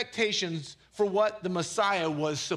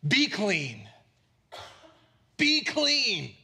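emphatic command; much slower than usual. Acted. Jesus commanding the leper to be healed, emphatic command. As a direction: call it out with authority, punching each word. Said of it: "You know how he said it?".

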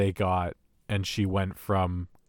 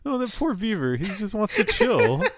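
high-pitched cooing sympathy, laughing; playful pity; 10 semitones higher than usual. Real, not acted. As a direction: coo over the poor creature, amused. Mock pity for a fictional beaver.

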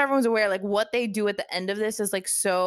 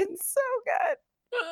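high, drawn-out squeal of delight; overwhelmed gushing; 4 dB quieter, slower than usual. Real, not acted. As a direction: gush as if nearly in tears. Gushing in mock-tearful excitement.

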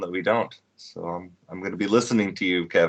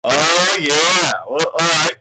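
high, loud whooping cheer; excited cheer; 10 semitones higher, 8 dB louder, much slower than usual. Real, not acted. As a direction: cheer them on, thrilled. Cheering a friend's big news.

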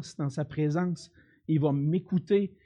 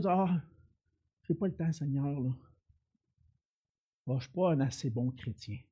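mumbled excuses; 5 semitones lower, 5 dB quieter than usual. Retold or imitated. Voicing excuses of believers.

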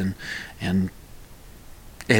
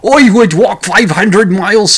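loud, high, hammed-up bad Scottish accent; 9 semitones higher, 8 dB louder than usual. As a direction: belt it out in a badly faked Scots brogue. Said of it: "that's not Scottish at all".

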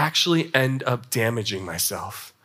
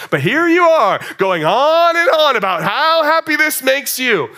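acted outrage building from restrained to high and loud; mock indignation; 6 semitones higher, 4 dB louder than usual. Wife scolding husband over his own happiness, mock indignant. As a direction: play an accusing spouse, stretching the words as it escalates.

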